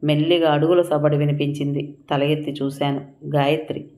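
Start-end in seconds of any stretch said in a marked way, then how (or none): none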